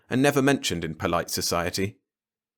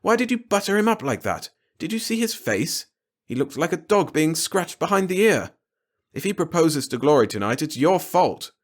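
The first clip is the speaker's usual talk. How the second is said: higher, exasperated husband; exasperated scolding; 8 semitones higher than usual. Acted. A father blaming his wife, exasperated. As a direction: vent at her for fawning.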